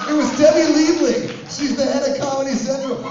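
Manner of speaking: loud, high, emphatic reveal; shouted punchline. Real, not acted. Comic revealing Debbie's identity to the crowd, real excited shout. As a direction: shout it over the audience's laughter.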